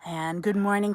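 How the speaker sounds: ordinary talk, nothing marked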